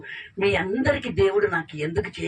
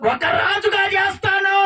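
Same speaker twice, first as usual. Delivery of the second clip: higher, loud divine promise; booming promise; 9 semitones higher, 3 dB louder than usual. Acted. Angel promising Hagar her son will be king, booming vow. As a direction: proclaim it like a vow.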